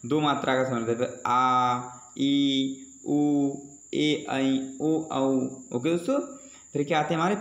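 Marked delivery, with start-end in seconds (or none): 1.3-5.2 आ, ई, उ, ए, ऐ, ओ, औ each held longer; long vowel demo. Shown as a demonstration. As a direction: stretch every vowel आ ई उ ए ऐ ओ औ. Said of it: "जिसे बोलने में ज़्यादा समय लगे". (दीर्घ स्वर खींचकर)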